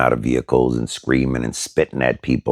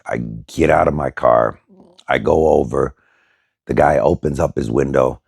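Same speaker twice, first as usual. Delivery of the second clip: ordinary delivery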